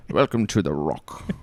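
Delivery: slow, low Connery voice with a Scottish lilt; Sean Connery impression. Asked for a quote from The Rock, slow low Connery line. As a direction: savour each word like Connery. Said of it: "Dude, you're, you're good".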